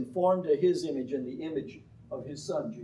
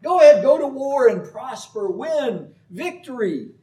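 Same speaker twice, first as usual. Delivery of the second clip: much higher, louder mock cheer; sarcastic; 10 semitones higher, 8 dB louder than usual. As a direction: pour on the sarcasm, cheering falsely. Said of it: "he uses sarcasm".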